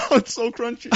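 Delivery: giggling through the words; laughing while chewing. Real, not acted. First bite of cricket bar.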